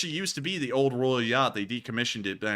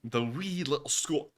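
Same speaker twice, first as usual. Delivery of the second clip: put-on higher, playful Scottish accent; 4 semitones higher than usual. Host echoing the co-host, put-on Scottish accent. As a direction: echo it in put-on Scots. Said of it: "try and do the Scottish accent".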